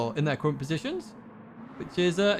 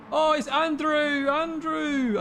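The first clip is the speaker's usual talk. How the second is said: high, drawn-out Scottish exclamation; full Scottish accent; 9 semitones higher, much slower than usual. Real, not acted. Commentator spots who went off, slipping into full Scottish. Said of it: "why I went full Scottish".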